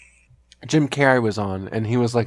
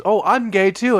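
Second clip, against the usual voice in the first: much higher, eager and bright; high excited reply; 9 semitones higher than usual. Retold or imitated. Sketch partygoer answering Johnny, high and delighted. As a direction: squeal it out delighted. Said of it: "this other guy goes".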